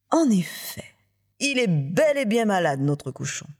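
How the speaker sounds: mock surprise, high start sliding down; surprise feinte. A narrator's reading. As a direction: feign surprise as you agree. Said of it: "Feignant la surprise". (surprise feinte, exagérée avec retenue)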